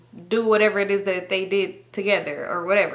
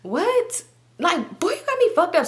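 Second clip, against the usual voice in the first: high, outraged friend talking back; mock offense, pitched way up; imitating a friend's indignant snap; indignant sass; 9 semitones higher, slower than usual. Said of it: "So she was like".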